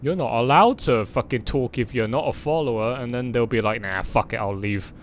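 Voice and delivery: normal talking voice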